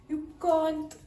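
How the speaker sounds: ordinary talk, nothing marked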